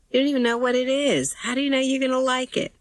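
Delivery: very high-pitched parent's questioning; teasing question. A narrator's reading. Parent questioning daughter over an unopened gift, high-pitched questioning.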